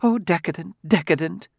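sniffing, disdainful decadent; sniffy disdain. Retold or imitated. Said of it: "sniff and say".

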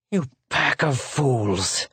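slow, choked, furious insult; passion-choked fury. A narrator's reading. Secretary at his armed captor, choked with passion. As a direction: choke the words out with rage. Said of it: "shrilled the secretary, passion-choked".

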